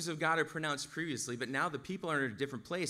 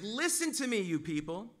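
high, raised call to a crowd; mock shout, pitched up high; retold shout; 6 semitones higher than usual. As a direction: call out as if from a hilltop. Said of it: "shouts to them".

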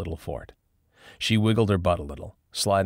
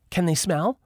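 Lauren's voice; 12 semitones higher than usual. A narrator's reading. Lauren asks, high.

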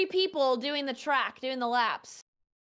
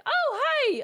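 startled; 13 semitones higher, 4 dB louder than usual. Retold or imitated. Startled by a stranger.

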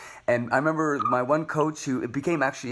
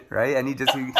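ordinary talk

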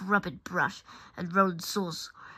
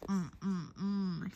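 soft closed-mouth "mm, mm, mm"; muffled mumbling; 8 dB quieter, much slower than usual. A narrator's reading. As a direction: try to talk through a taped-up mouth. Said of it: "plaster stuck over your mouth".

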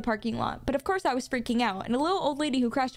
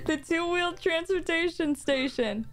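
mock-grand; 5 semitones higher than usual. Mock-grand naming of the bike.

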